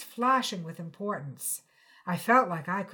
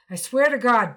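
loud, urgent vow; earnest outburst; 3 semitones higher, 12 dB louder than usual. Acted. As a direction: blurt it out to be believed. Boyfriend pleading on phone.

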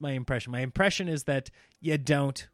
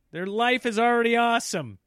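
ordinary talk, nothing marked

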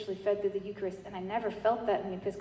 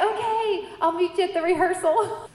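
high, loud quick yes; imitating a delighted sponsor; excited agreement; 7 semitones higher, 6 dB louder than usual. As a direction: agree eagerly with voice lifted. Sponsor's excited retold reply.

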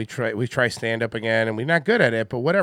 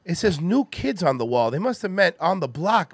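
higher Boston tough-guy voice, worried; a puzzled Boston guy in a tunnel; concerned Mark Wahlberg; 4 semitones higher than usual. Acted. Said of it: "impression of a concerned citizen Mark Wahlberg".

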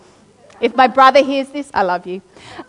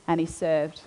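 and he served, trailing off much softer and lower; fading repetition; 6 semitones lower, 8 dB quieter than usual. Shown as a demonstration. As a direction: let the last and he served die away.

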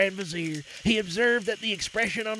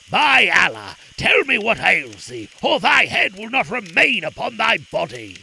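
furious king shouting a threat; angry Sultan; 7 dB louder than usual. A narrator's reading. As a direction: bellow it like an enraged sultan. Sultan threatens with drawn sword.

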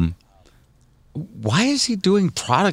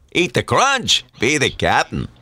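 imitating Captain Crunch; 5 semitones higher, slower than usual. Host imitates the audition.

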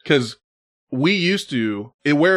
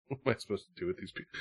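ordinary delivery

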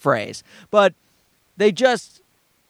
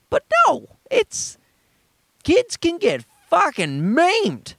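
emphatic protest; 11 semitones higher, slower than usual. Real, not acted. Emphatic protest about kids' safety.

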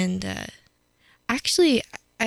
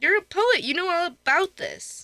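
pitched way up, teasing; high teasing voice; 10 semitones higher than usual. Pretend. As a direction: tease him in a squeaky voice.

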